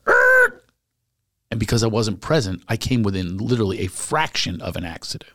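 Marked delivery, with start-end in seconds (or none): 0.1-0.6 startled yelp. Retold or imitated. Near-crash in the car.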